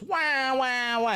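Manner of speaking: high, loud wah wah crybaby noise; mock crying baby. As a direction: whine it like a bawling toddler. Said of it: "when he goes".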